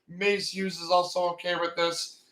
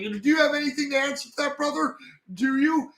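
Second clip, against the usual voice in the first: fast, much higher demanding challenge; mock accusing crescendo; 7 semitones higher, faster than usual. Alphonse demanding Edward answer him, fast high accusing. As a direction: rattle it off high, pressing for an answer. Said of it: "on his crescendo".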